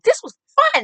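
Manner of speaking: very high, loud squeal of delight; mock enthusiasm. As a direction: imitate an over-excited mom. Mother's enthusiasm, imitated.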